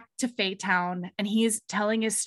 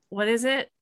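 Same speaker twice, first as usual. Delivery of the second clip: ordinary delivery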